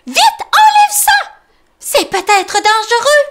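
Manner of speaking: very high, loud panicked mole voice; Tom crying out in alarm. Acted. Tom warning Grumbo about the hat, high panicked cry. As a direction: squeal a warning. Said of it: "s'écrie Tom".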